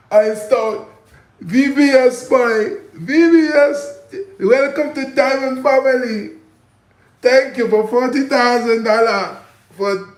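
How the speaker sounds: high, loud, slow put-on salesman voice. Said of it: "Johnny Dang probably talk like this".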